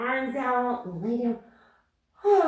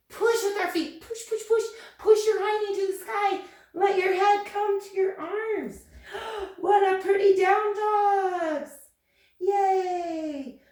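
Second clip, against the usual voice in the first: excited cheer; 9 semitones higher, 6 dB louder than usual. Acted. Cheering kids into down dog.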